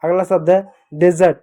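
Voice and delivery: ordinary delivery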